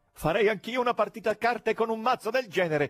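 jolly spectator; cheerful fat man. A narrator's reading. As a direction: propose it jovially. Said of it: "propose con allegria un grassone".

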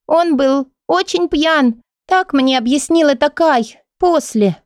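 put-on high, slow child voice; little girl voice. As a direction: play a small girl telling. Child recalls being hit.

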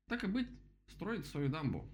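high-pitched storybook bird; voiced woodpecker character. A narrator's reading. Woodpecker approves the dam, high bird voice.

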